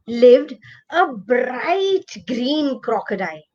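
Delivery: usual speaking voice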